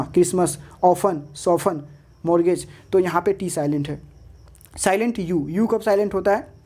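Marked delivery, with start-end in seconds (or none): none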